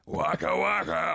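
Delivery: mock Fozzie Bear catchphrase; goofy puppet bear voice, slowed. Mocking the actor as Fozzie Bear, in a silly puppet voice. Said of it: "Fozzie the Bear doing Batman".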